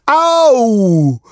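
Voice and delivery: high loud yelp of pain; a pinched dog yelping; dog's ouch. Acted. Dog pinched by duck, loud high yelp. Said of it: "zawołał Pypeć".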